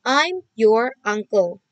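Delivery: very slow and distinct; a teacher showing children how to read the answer; slow model reading. Shown as a demonstration. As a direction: read it out word by word. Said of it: "pakai your kan".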